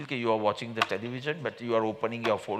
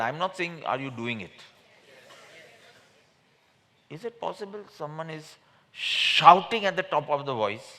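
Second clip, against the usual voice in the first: ordinary talk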